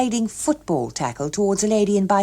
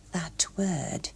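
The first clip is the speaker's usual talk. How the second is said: hushed whisper of the word; whispering the forbidden word; 9 dB quieter than usual. A narrator's reading. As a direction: breathe it like a secret.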